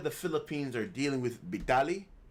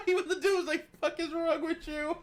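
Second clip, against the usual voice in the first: can barely talk for laughing; laughing hard. Real, not acted. Host reacting to a prank clip, laughing hard. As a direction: quote squeezed out, high, through laughter.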